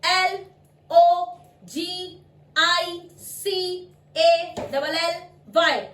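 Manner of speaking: letters spelled out slowly and loud; slow spelling out. Shown as a demonstration. Teacher spells out the word slowly. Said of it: "बोल के दिखाती हूँ".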